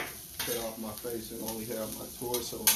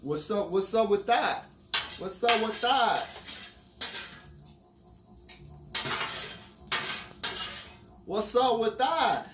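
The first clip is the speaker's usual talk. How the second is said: loud calling out; 9 semitones higher, 3 dB louder than usual. Acted.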